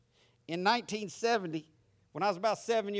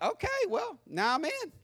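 put-on much higher, pleased little boy; kid's glee; 11 semitones higher than usual. Newly saved boy thinking he joined the club, high. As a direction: squeak it out proudly. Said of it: "and I'm like".

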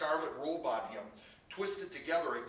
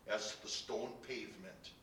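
ordinary delivery

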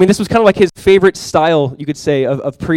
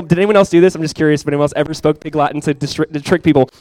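ordinary talk

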